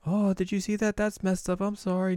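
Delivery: high, light sorry voice; high fake sympathy. Kikyo apologizes to the kid, high sugary voice. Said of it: "and Kiki's like".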